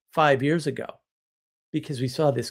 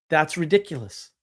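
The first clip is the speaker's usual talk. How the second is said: usual speaking voice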